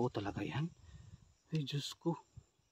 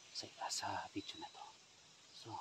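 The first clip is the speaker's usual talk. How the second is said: whispering while hiding; 3 semitones lower, 15 dB quieter than usual. Real, not acted. Hiding, afraid of being seen.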